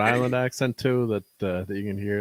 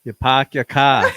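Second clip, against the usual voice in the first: loud, slow Boston accent; Boston, Rs dropped and vowels stretched; 3 semitones higher, 7 dB louder, slower than usual. Shown as a demonstration. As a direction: show off a broad Boston accent on request. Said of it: "I love your accent so much".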